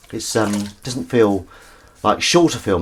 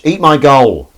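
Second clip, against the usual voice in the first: raised, punchy sports commentator cry; Partridge; 5 semitones higher, 8 dB louder, slower than usual. Retold or imitated. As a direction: shout it like an excitable football reporter. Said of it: "that's my favourite Partridge quote".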